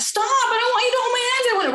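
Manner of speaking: put-on high-pitched upset child protesting. Child objects to having hands held, high upset imitation. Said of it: "be upset about that".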